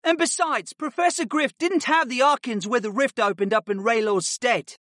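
high-pitched pupil defending his teacher; eager correction in a high voice; earnest boy student. A narrator's reading. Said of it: "Artie pointed out".

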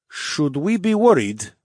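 slow, wry half-serious question; half-joking. Acted. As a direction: ask it half in jest. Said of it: "in a half-joking tone".

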